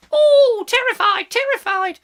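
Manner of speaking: very high, loud pretend fright; mock terror. Host agreeing kids feared the police, mock-terrified squeal. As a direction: squeal it in exaggerated dread.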